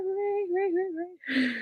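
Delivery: high, playful cheering; excited cheer. Real, not acted. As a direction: cheer her arrival up high. Cheering Lana's arrival.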